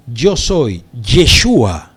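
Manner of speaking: slow, weighty self-declaration; divine voice. Acted. Preacher voices the answer to Saulo, slow and emphatic. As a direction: state the name slowly, with weight. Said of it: "Y la voz le responde".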